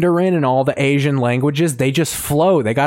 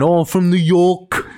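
mock New York accent; 4 semitones higher than usual. Answering a request for an American impression, mock New York accent. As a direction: do a Brit's bad New Yorker. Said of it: "Do an impression of American".

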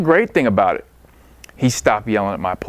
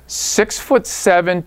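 normal talking voice

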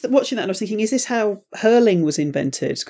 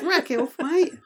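high, softer mock-Aussie catchphrase; Nicole Kidman; 10 semitones higher, 5 dB quieter, slower than usual. Repeating the star's supposed catchphrase, mock Australian accent. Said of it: "that's her catchphrase".

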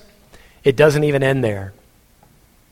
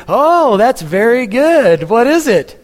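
high, loud, slow fake delight; fake delighted parent; 7 semitones higher, 4 dB louder, slower than usual. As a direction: gush over the drawing you can't read. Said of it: "and you went".

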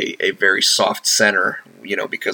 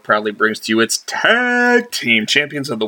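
ringmaster; 3 semitones higher, 4 dB louder than usual. Acted. Hyped show announcement.

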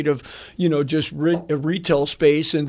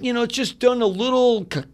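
ordinary talk

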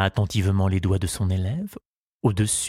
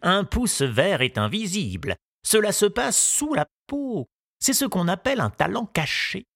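lightly voiced old gardener, solemn and pitched up; 8 semitones higher than usual. The gardener telling Tistou the news, with a light old-man voice. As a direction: deliver the verdict gravely, a hint of an old man. Gardener explains green thumbs.

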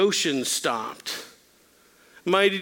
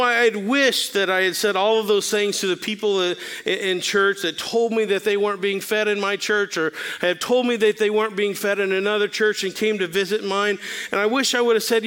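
ordinary talk, nothing marked

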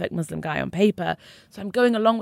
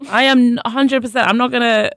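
louder, owning it without apology; emphatic honesty; 5 semitones higher, 9 dB louder than usual. Real, not acted. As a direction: say it straight out, with force. Insisting she won't pretend.

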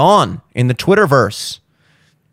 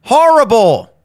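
high, loud, drawn-out disgust; disgusted verdict; 10 semitones higher, 6 dB louder than usual. Real, not acted. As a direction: spit out the word like a bad taste. Ranting about a bad meal.

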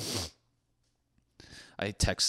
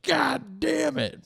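much higher, slow exasperated squeak; Jesus cursing; slower than usual. Retold or imitated. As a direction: whine it in a high put-on voice. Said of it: "He's like".

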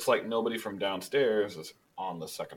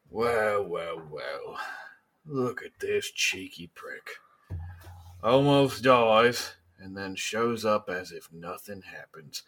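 slow, mocking tough-guy drawl; menacing gang leader; slower than usual. Gang leader greets Abner at the bar, slow and mocking. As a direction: draw it out, sizing up a rival. Game master voices Joel.

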